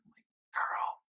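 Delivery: unvoiced whisper to Taylor; whispered aside. Real, not acted. Vlogger whispering a teasing aside to Taylor. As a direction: breathe it like a secret.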